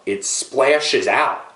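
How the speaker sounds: a slow, grossed-out splashes out; slow emphasis. Acted. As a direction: drag out splashes for effect.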